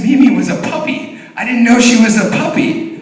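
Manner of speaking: loud, high mock child voice; amazed little boy. Four-year-old son explaining why he laughed, high and amazed. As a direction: imitate a small son's astonished discovery.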